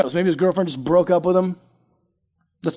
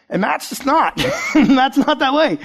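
ordinary talk

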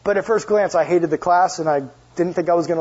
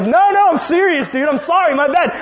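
higher, louder frantic sorry; panicked apology; 10 semitones higher, 5 dB louder than usual. Retold or imitated. The speaker apologizes to the stranger with the cart, high and panicked. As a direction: blurt out an alarmed apology. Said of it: "And I'm like".

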